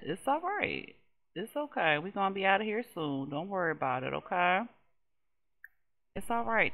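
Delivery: soothing. Acted. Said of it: "She like".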